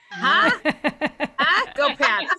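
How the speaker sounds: put-on loud, brash Boston accent. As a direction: ham up a thick Boston accent. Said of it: "the accent was affecting my sex life".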